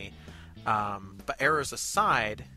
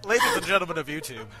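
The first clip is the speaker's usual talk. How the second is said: quick, louder announcer-style address; mock announcer; 3 semitones higher, 5 dB louder, faster than usual. Host addresses the YouTube audience after a fumble, mock announcer. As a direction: hail the audience like a showman.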